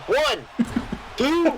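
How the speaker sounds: rising excited count; referee count. Pretend.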